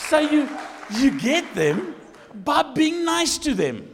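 loud, high, drawn-out exhortation; slow down and preach it loudly; full-force preaching. Real, not acted.

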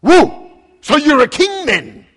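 loud, high mocking whoop of disbelief; hammed-up sarcastic governor scoffing at the prisoner. Pilate mocking Jesus's claim to be king, loud sarcastic whoop. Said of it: "he's being very sarcastic".

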